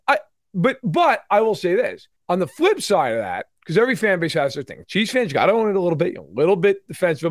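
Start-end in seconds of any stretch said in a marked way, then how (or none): none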